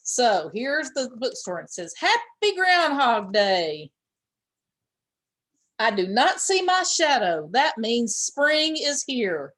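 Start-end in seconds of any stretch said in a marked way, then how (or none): 2.0-9.5 slow, drawn-out bright reading, a bit louder; sign read aloud. Acted. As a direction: read the sign slowly like a cheerful announcement.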